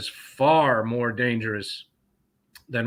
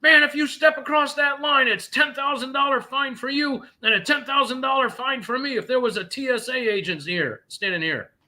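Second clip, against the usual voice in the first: high-pitched urgent worker voice; anxious warehouse worker; 13 semitones higher than usual. Retold or imitated. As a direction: play the jittery guard warning him off. Worker's voice in retold story.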